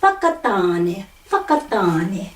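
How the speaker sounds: Whakatane with Wh as f and long ta; Māori pronunciation. Shown as a demonstration. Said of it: "commonly pronounced f".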